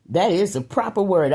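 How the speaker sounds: ordinary talk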